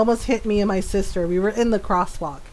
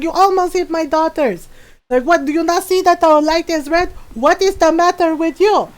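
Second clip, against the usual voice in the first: mock angry yell; 6 semitones higher than usual. Mom yelling at the driver who nearly hit her daughter, high angry shout. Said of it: "and then yelling".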